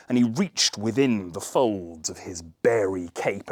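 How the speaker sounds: ordinary delivery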